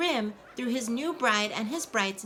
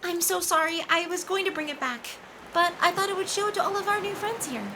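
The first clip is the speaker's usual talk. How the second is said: higher, quick sheepish sorry; flustered apology; 7 semitones higher than usual. Acted. Nymphia apologising to Thor for the book, quick sheepish high voice. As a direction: rush out the excuse.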